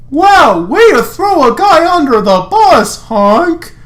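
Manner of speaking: high, loud, indignant yelp; outraged Lance. Acted. Lance yelling at Hunk for betraying him, high and loud. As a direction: squawk in betrayed disbelief.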